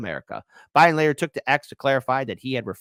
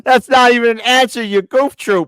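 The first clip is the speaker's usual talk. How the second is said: incredulous outburst; 10 semitones higher, 10 dB louder than usual. Real, not acted. Mocking reaction to clip.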